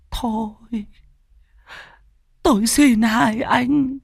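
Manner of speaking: high, drawn-out tearful pleading; tearful old mother. Acted. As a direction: plead slowly in a very high, weeping old woman's voice. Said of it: "nước mắt bà trào ra".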